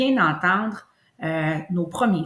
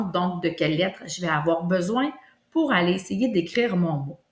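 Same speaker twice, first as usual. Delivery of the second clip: usual speaking voice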